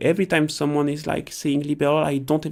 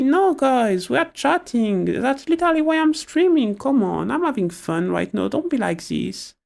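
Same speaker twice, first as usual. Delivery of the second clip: higher-pitched, pleading protest to the chat; protesting in a raised pitch; playful protest; 8 semitones higher than usual. Real, not acted. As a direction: plead with them to let you have fun. Streamer protests at chat's complaint.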